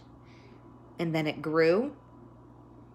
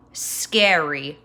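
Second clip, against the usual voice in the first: plain reading voice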